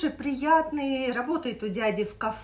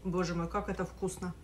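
chewing between bites; eating pasta while talking; mouth full; 5 semitones lower, 8 dB quieter than usual. Real, not acted. Seen 1: Mid-meal, fork of pasta raised; lips moving after bites before and after.

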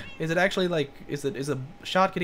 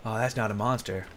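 usual speaking voice

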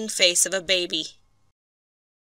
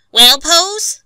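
much higher, louder demanding question; impatient; 9 semitones higher, 9 dB louder than usual. A narrator's reading. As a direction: push him for an answer. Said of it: "interrogated Skid impatiently".

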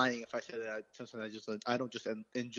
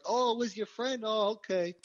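mock gullible cop; 9 semitones higher than usual. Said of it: "the cop was gonna be like".

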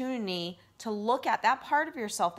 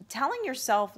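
usual speaking voice

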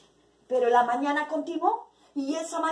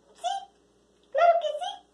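voz del ayudante. Acted. Ayudante responde, voz distinta.

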